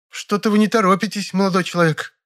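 high, peevish rival actress voice; displeased. A narrator's reading. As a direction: complain from behind the screen. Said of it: "раздалось недовольное". (недовольно, высоким голосом)

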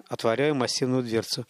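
plain reading voice

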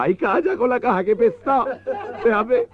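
very high, wailing camp sob; dramatic crying widow. Acted. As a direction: overact the grief of a heartbroken lover. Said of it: "con la vena dramática llorando".

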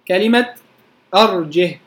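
usual speaking voice

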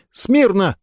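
high thin squeak of an order; squeaky command. Acted. Skinny sergeant squeaks the command to the cadets. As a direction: squeak it out, no authority. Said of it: "пискнул парнишка".

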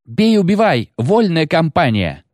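piercingly high female battle cry; high soprano war cry. A narrator's reading. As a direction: cut through the roar with a very high rallying call. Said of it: "высокая сопрано Джули Абатемарко".

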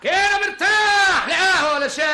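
high, loud protest; insistent high-pitched refusal. Acted. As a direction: push away the offer loudly.

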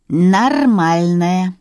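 drawn-out, contrary retort; objecting. A narrator's reading. Woman countering a doubt about the phrase, objecting. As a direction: stretch the word out in contradiction. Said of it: "возразила Вера".

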